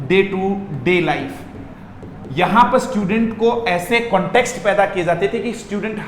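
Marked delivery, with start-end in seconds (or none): 0.1-1.3 drawn out slowly, each word apart; slow repeat. Shown as a demonstration. Teacher repeating slowly for students to follow. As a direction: spell it out slowly for the class. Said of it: "बोलो ना क्या बोल रहा हूं".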